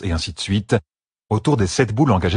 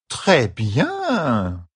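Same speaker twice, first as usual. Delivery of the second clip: drawn-out, higher mock approval; sarcastic praise with a raised pitch; ironic; 5 semitones higher than usual. A narrator's reading. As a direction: say it with lingering irony. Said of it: "ironisa Hydrel".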